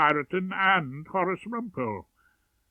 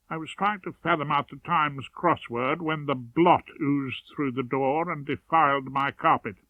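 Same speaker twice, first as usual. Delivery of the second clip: ordinary delivery, nothing marked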